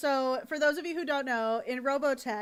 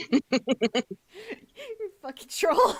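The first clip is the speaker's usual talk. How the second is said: words swallowed by a high laugh; laughing hard; 10 semitones higher, 7 dB louder than usual. Real, not acted. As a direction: laugh through the insult. Laughing at chat teasing.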